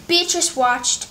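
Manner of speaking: ordinary delivery, nothing marked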